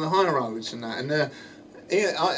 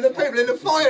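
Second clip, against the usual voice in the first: normal talking voice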